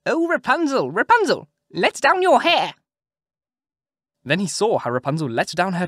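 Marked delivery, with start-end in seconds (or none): 0.1-2.7 high, loud witch's call up the tower. A narrator's reading. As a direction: sing it out high like the old witch. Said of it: "listened while she called out".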